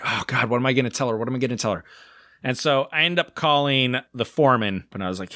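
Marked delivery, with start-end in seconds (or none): 0.0-1.8 mock panic. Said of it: "sitting there freaking out".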